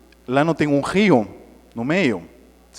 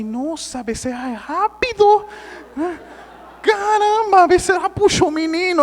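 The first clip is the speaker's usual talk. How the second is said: high-pitched comic astonishment; hammed-up amazed exclamation; retold amazement; 13 semitones higher, 4 dB louder than usual. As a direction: marvel loudly at a quick rescue.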